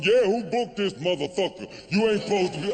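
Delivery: lightly voiced indignant man in the crowd; mock audience complaint; heckling dude.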